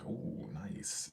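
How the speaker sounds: a breathy whispered "ooh, nice". Real, not acted. Reacting to the viewer's sunny Ireland, whispered. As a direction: drop to a whisper of delight.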